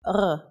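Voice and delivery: R made in the throat, like French; a guttural, gargled R. Shown as a demonstration. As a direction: roll the R in the throat, French style. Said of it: "similar to the French".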